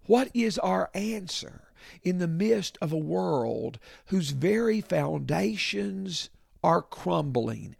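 ordinary delivery

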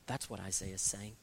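usual speaking voice

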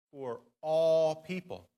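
all drawn out and leaned on, slowly; stress on all. Shown as a demonstration. Pastor reading scripture to his church, leaning on all. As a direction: make all the heaviest word. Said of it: "I'm going to emphasize a certain word".

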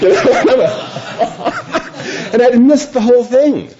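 high, slow laughing confession; laughing at himself. Real, not acted. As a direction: laugh at your own blunder.

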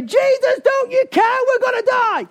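high, frantic acted outcry; exploding in panic. Frightened disciples waking Jesus in the storm, exploding in panic. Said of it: "until the point someone explodes and say".

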